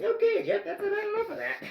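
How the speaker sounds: very high falsetto reprimand; mock scolding voice. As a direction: imitate a squeaky telling-off. Imitates someone reining him in.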